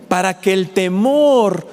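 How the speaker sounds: slowing down, voice sinking at the end; slow solemn quote. Pretend. Preacher voicing God's answer, slow and solemn. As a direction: let the promise land slowly.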